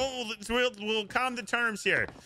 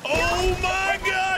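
high, drawn-out exclamation; astonished cry; 6 semitones higher, 4 dB louder, much slower than usual. Real, not acted. Player amazed by a midair shot, astonished cry. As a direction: stretch it out in amazement.

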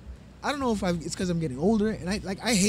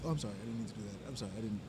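much softer, lower, sheepish mock sorry; meek apology; 9 semitones lower, 13 dB quieter, faster than usual. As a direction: drop to a small voice, suddenly apologetic. Lady suddenly apologizes after hitting.

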